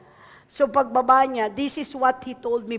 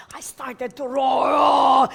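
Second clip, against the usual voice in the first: roaring; 6 dB louder, much slower than usual. Real, not acted. Preacher reenacting roar.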